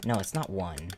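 normal talking voice

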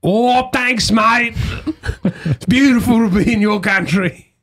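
high, hammed-up Australian accent; exaggerated Australian accent. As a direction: ham up the Aussie for American fans. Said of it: "I now do an Australian accent".

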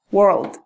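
"World" said whole and clearly; model pronunciation. Shown as a demonstration. Teacher joining the sounds back into "World". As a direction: join it into one clean "World". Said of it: "¿Sí vieron?".

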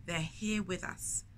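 ordinary delivery, nothing marked

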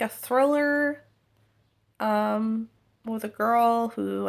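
ordinary talk, nothing marked

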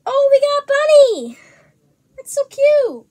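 gasp, then high, loud squeal; real thrilled outburst over a cute surprise; excited delight. Real, not acted. Vlogger finds a bunny in an egg, squealing with delight.

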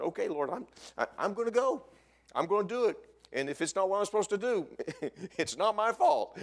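high, loud mock surrender to God; comic, worked-up surrender; giving in. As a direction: agree loudly while disclaiming blame, breaking into a laugh. Acted giving in, disclaiming blame.

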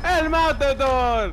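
loud, high, drawn-out shout; long playful victory call; triumphant catchphrase. Pretend. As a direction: bellow it like a battle cry.

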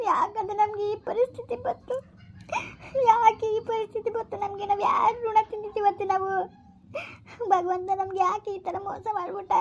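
tearful lament. Acted. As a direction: let the tears break each question. Daughter-in-law breaks down to mother-in-law. (ಅತ್ತೆಯ ಮುಂದೆ ಅಳುತ್ತಾ ಸೊಸೆ ದುಃಖ ತೋಡಿಕೊಳ್ಳುವುದು)

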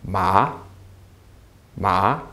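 rising pitch on MA; second tone. Shown as a demonstration. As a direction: lift MA like a surprised question. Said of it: "your voice is rising".

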